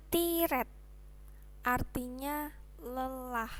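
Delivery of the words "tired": "tired" read as written, TEE-red; spelling pronunciation. Shown as a demonstration. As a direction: sound out "tired" with Indonesian I and a clear R.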